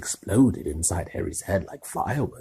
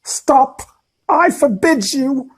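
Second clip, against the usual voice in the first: loud, high panicked shout; panicked yell; 11 dB louder, slower than usual. Acted. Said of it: "yelled Uncle Vernon in panic".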